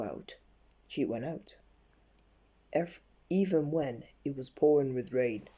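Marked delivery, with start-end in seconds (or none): none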